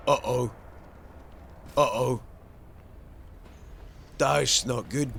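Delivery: ordinary talk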